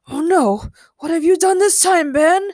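high, loud dismayed question; unhappy father. A narrator's reading. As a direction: scold your son in dismay. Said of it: "didn't appear to be very happy".

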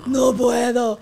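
hammed-up "I can't", loud after a gasp; exasperated outburst. Mother answers daughter she can't let go, exasperated. Mother acts out helpless reaction.

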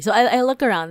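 fast, loud, excited rush. Real, not acted. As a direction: rush ahead, excited by the story. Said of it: "my voice is getting high-pitchy 'cause I'm, like, getting all excited".

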